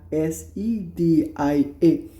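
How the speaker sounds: very slow letter dictation; letters spaced far apart; slow spelling. Shown as a demonstration. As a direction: spell সেদিয়া carefully. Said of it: "spelling out".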